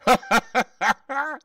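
very high, loud ha-ha-ha; hammed-up company laughter; lusty roar of laughter. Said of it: "roared out lustily".

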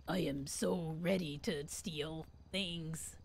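low, slow, soft put-on robber; fake voice. Gamer practising to be a thief, fake voice. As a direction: put on a deep, hushed voice and take it slowly. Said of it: "I'll use that voice".

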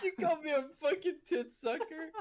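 high, laughing speech; real crack-up, words squeezed through laughter; helpless laughter. Real, not acted. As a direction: talk while cracking up. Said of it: "I'm crying".

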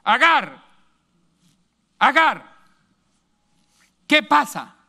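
the angel of the Lord calls down to a weeping Agar; angel calling Agar. Acted. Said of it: "El ángel del Señor".

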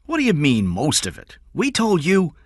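high, loud, affronted challenge; demanding. Acted. Kidnapper hearing the money is short, demanding. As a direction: press him for an answer.

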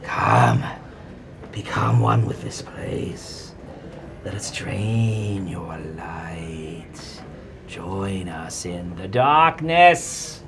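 slow, eerie crone chorus luring; drawn-out creepy whispering lure; many snarling voices overlapping. Acted. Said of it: "the three heads say in unison".

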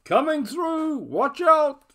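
loud high bellow; shouting. Acted. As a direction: shout a warning while charging. Pig charging through clearing.

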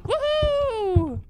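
high, loud whoop; cheering. Real, not acted. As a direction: whoop with joy. Cheering success.

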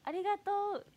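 a high, bright-up mimic of Yuka's thank-you; imitating Yuka. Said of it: "ゆかちゃんの真似したら怒る？".

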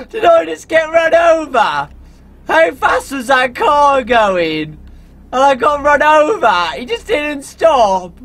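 high, loud disbelieving shout; shocked outcry. Real, not acted. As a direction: yell in outrage at being run over. Got run over by car.

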